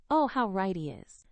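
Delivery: ordinary delivery, nothing marked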